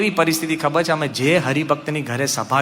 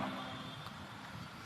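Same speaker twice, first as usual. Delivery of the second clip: nervous whisper; 28 dB quieter, much slower than usual. Retold or imitated. Nervous about knocking on door.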